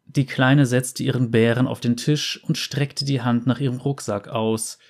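usual speaking voice

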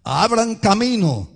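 crying out. Acted. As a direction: call out to clear the way. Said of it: "una voz que clama".

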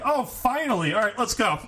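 high, relieved henchman voice. Retold or imitated. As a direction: say it like he's been waiting. Henchman fooled by disguise.

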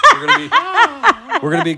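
laughing through the words. Real, not acted. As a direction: giggle while you talk. Laughing after the hawk call.

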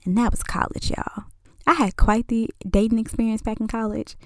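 hushed confiding whisper. Real, not acted. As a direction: lean in and whisper it like a secret. Aside to viewers.

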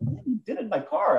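high-pitched retold grievance; exaggerated, indignant whine in retelling; mock complaint. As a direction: play up the wounded car owner. Acted complaint to the cyclist.

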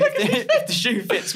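high, slow through laughter; laughing. Real, not acted. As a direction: squeal it out while laughing.

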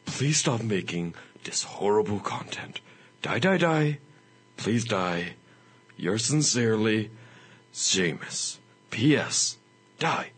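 hammed-up sinister letter reading; mock-threatening, half-whispered, with long pauses; menacing hate-mail writer. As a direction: read it slowly as a creepy ill-wisher, sinking into a whisper. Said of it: "I have a letter for you".

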